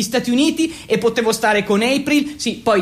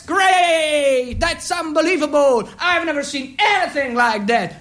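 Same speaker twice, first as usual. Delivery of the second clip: mock American shout of delight; hammed-up enthusiastic praise; excited cheer; 5 semitones higher, slower than usual. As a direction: cheer it like a thrilled examiner. Commission cheers the recital.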